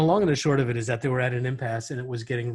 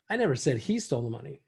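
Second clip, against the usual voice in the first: "he" lifted out with a jump in pitch; stress on HE; faster than usual. Shown as a demonstration. Trainer showing how inflection changes meaning, stressing "he". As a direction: put all the emphasis on "he", as if singling him out. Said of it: "the inflection of your voice".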